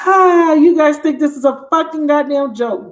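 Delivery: high, emotional exclamation, near tearful; overjoyed outburst. Real, not acted. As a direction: cry it out, overcome with happy disbelief. Overcome with joy at the card.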